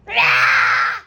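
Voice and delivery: high, loud scary shout; scary monster roar. Shown as a demonstration. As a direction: burst out with a monster scare. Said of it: "Scary face".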